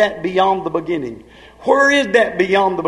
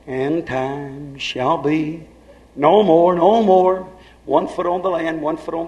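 very slow, hushed start swelling into no more; intoned scripture; 4 semitones lower, slower than usual. Acted. Preacher intoning scripture to the church, drawn out. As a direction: intone the verse like a prophecy.

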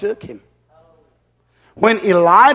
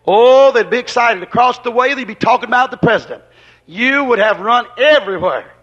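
a touch higher, animated sermon voice; lively preaching; 4 semitones higher than usual. Acted. Preacher imagining the town buzzing, lively sermon voice. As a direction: paint the excitement for the crowd. Preaching about the president visiting.

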